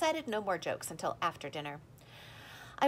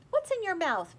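high, sharp alarmed question; a mother suddenly worried; 12 semitones higher, 6 dB louder than usual. A narrator's reading.

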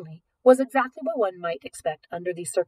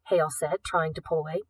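usual speaking voice

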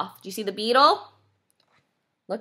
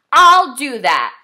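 loud, drawn-out storybook screech; grouchy ladybug yelling; 3 semitones higher, 9 dB louder than usual. A narrator's reading. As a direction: belt it out slowly as a defiant bug. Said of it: "screeched the grouchy ladybug".

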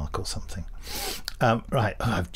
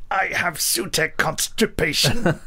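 mock villain; 6 semitones higher than usual. Joke villain line.

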